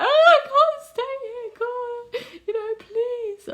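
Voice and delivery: high wailing plea, bursting loud then easing; mock crying. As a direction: act out a desperate tearful wail. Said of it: "we were just crying and crying".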